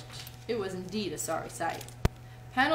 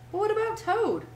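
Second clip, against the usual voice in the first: high, louder worried query; anxious question; 11 semitones higher, 5 dB louder than usual. A narrator's reading. Mole worries about leaving Toad behind, anxious. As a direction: ask with rising concern. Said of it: "asked the mole anxiously".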